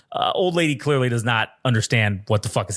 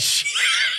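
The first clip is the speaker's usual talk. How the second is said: sharp squeal of a laugh; a real, very high laugh bursting out; high burst of laughter. Real, not acted.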